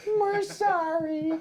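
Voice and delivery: meek apology. Retold or imitated. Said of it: "both their heads go".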